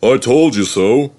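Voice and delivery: rude. A narrator's reading. Gordon gloating at Edward, rude voice. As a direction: gloat rudely. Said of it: "said Gordon rudely".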